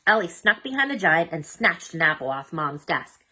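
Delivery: usual speaking voice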